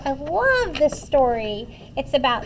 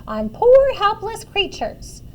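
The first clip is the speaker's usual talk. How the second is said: higher-pitched cross mother; raised-voice telling-off; scolding yell; 6 semitones higher, 4 dB louder than usual. A narrator's reading. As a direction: scold like a fed-up mama. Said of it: "she yelled".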